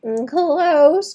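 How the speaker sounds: ordinary delivery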